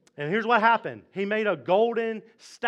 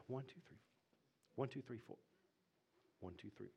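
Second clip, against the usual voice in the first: very soft, low murmured count; counting under his breath; 6 semitones lower, 24 dB quieter, faster than usual. Acted. King watching the furnace counts the people, murmuring. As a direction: count to yourself, barely audible.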